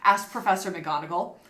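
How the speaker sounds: ordinary talk, nothing marked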